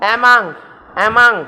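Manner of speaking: "among" ending in ng, no g; careful "among", closing on a nasal ng; final ng sound. Shown as a demonstration. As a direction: say "among" as uh-mung. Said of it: "ങ് സൗണ്ടാ last".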